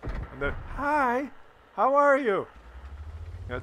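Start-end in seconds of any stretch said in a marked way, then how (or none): 0.8-2.5 high mock polite dad greeting; sweet dad. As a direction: switch to sugary politeness. Dad suddenly polite on camera.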